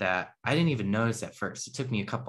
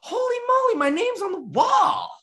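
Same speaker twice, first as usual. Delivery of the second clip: much higher, louder playful celebrity boast; joking excitement; 5 dB louder than usual. Retold or imitated. Showing off to the brother, hammed-up giddy boast. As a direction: ham it up like a thrilled star. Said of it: "I went completely opposite".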